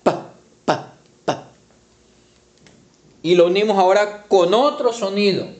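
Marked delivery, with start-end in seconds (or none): 0.0-1.5 "Pa, pa, pa" as a whispered, breathy p sound; isolated sound. Shown as a demonstration. Teacher isolating the p sound for the children. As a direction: tap out the bare p sound. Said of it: "Eso es un sonido".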